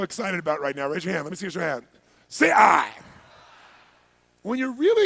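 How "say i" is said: loud shouted cue to the crowd; rallying shout. Real, not acted. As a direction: belt it out so the room answers. Calls for audience response.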